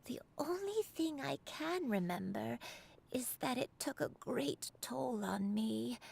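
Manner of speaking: put-on slow, hesitant elderly recollection; an old woman struggling to remember; frail old woman. Granny recalls illness, low voice.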